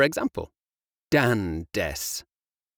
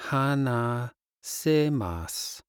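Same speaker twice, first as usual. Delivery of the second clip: slow; slower than usual. Shown as a demonstration. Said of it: "Do you speak English?".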